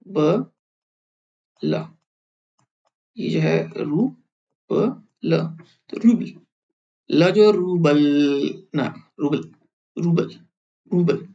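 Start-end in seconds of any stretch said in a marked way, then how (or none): 5.9-11.3 रूबल with the final ल cut short and held back; रूबल with a clipped soft final ल; soft sign demo. Shown as a demonstration. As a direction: stop the ल at the end of रूबल. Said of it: "रोक लगा रहे हैं".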